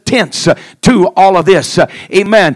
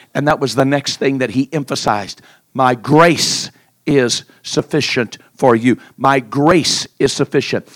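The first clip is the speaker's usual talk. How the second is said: hushed sermon; 10 semitones lower, 10 dB quieter than usual. Acted. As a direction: draw the church in quietly. Soft, hushed intimate preaching.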